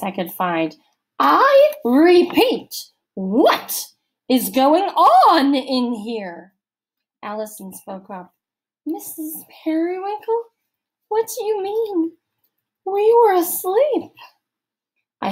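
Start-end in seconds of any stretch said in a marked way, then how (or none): 1.2-6.5 slow, loud, much higher repeat; repeated demand. A narrator's reading. Missus Periwinkle repeating her question to the girls, slow and loud. 8.9-14.1 much higher, sweet fake-sleepy girl; feigned innocence. A narrator's reading. Allison pretending to Missus Periwinkle she was asleep, high and innocent.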